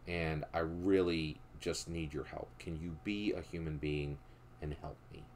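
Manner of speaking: a con man faking a heartfelt plea to the driver; genuine and sincere. Retold or imitated. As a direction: plead softly like you mean it. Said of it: "in a very genuine and sincere way".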